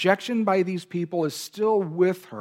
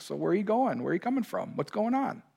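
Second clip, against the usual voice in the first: comical Lord; 4 semitones higher, 6 dB quieter, faster than usual. Retold or imitated.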